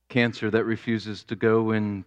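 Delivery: ordinary talk, nothing marked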